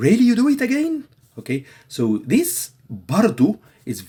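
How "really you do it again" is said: much higher, louder, fast indignant question; exasperated disbelief. Shown as a demonstration. Friend repeats a mistake, fast high exasperated disbelief. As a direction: fire it off in disbelief. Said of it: "a second time".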